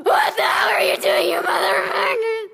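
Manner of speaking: high, loud outraged yell; furious shout. Acted. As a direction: scream at them, caught in the act. Dumbledore bursting in shouting.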